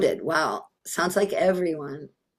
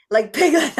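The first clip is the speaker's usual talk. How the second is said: laughing; 7 semitones higher than usual. Real, not acted. Laughing at Piglet reference.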